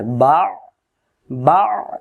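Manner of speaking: বা ending in a tight pharyngeal squeeze; Ain caught in throat. Shown as a demonstration. Teacher drills a hard syllable, throat-clamped end on বা. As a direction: choke off the end of each বা in the throat. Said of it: "গলা চাপ দিতে হবে".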